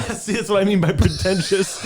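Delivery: laughing though it hurts; laughing through pain. Real, not acted. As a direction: laugh high and loud despite the pain. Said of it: "It hurts to laugh".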